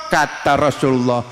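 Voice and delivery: usual speaking voice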